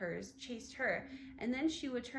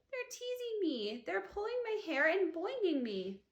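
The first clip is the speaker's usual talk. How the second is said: whiny complaint; 7 semitones higher than usual. A narrator's reading. Susan complaining to teacher.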